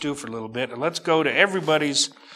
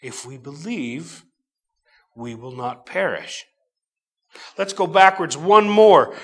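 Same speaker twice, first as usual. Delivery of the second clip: ordinary delivery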